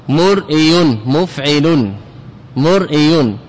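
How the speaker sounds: مرئي split as mur-ʔi-yun with a hamza break; original form sounded out. Shown as a demonstration. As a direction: say مرئي and مفعل syllable by syllable. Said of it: "يعني أصله".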